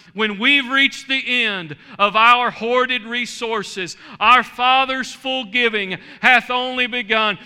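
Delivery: loud, high, rising preacher's cry, drawn out; full-force preaching. Acted. Pastor rousing the congregation at the sermon's end, full-force preaching. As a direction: shout the glory home to the church.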